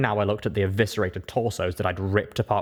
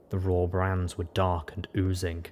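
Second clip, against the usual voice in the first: ordinary delivery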